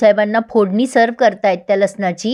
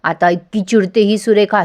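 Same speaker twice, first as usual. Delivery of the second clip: usual speaking voice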